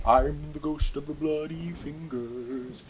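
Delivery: slow, eerie ghostly moan; spooky ghost voice. Acted. Ghost calling from the bathroom to the cyclist, eerie moan. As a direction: draw it out like a haunting.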